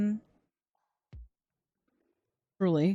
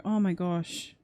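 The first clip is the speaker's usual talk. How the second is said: ordinary delivery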